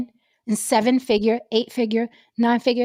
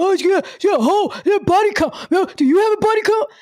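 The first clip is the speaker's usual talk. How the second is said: high, squeaky mock of a fan; 9 semitones higher than usual. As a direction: sneer it like a clueless guy online. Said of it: "Y'all gonna wait till".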